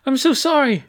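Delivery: very high, loud apology; high-pitched, anxious sorry; flustered apology. A narrator's reading. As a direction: blurt the apology high and urgent. Apologizing after crashing into someone.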